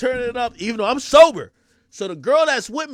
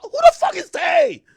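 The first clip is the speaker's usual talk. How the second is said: very high shouted disbelief; baffled outburst; 9 dB louder than usual. Retold or imitated. Man hearing another unknown name, baffled high shout. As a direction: squawk the question in bafflement. Baffled reply to his date.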